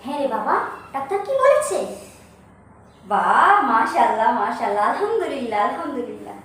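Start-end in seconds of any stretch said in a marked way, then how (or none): 3.1-6.4 grateful blessing. Acted. Hears good pregnancy news.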